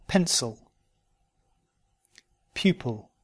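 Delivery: "Pencil" ending in "-sul", "Pupil" in "-pul"; weak I said "uh" in "Pencil" and "Pupil"; careful model words, I reduced to schwa. Shown as a demonstration. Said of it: "uh words where the uh is spelt with an I".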